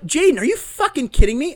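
high, loud incredulous outburst; retold exasperation. Host retells confronting ex over sent money, exasperated. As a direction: imitate scolding her in disbelief.

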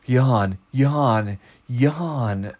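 mock orders to himself; playful self-command. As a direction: bark the word yawn at yourself, lightly. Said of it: "I command myself to yawn".

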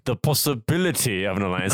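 lightly put-on French leader voice; French accent. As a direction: say it like a French diplomat. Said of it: "it's a French guy saying possibility".

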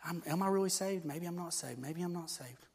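voicing a believer's private doubt; anxious doubting thoughts. Preacher voicing a doubting believer, soft and worried. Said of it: "You know, thinking".

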